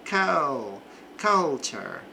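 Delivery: "culture" with an a-like vowel in "cul-"; "culture" starting kal; cul sound. Shown as a demonstration. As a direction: isolate "cul-" then say "culture". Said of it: "aqui é mais um som de cul".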